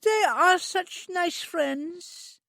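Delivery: put-on very high, slow childlike plea; begging like a child; high drawn-out childlike pleading; pleading Trudy. A narrator's reading. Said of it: "Trudy said pleadingly".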